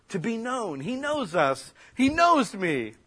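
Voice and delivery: high, rising to a loud cry; exultant. Real, not acted. Preacher rejoicing to the congregation at being known, exultant. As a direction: proclaim it with mounting joy.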